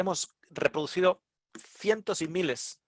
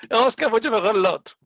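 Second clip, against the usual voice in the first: emphatic praise; 7 semitones higher, 5 dB louder than usual. Retold or imitated. Quotes the lady's repeated praise.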